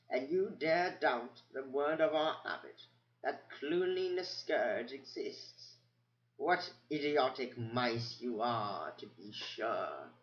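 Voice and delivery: put-on slow, withering old-mouse rebuke; scornful old mouse. Old mouse scolding the doubting mice, slow and scornful. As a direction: scold them slowly like foolish children.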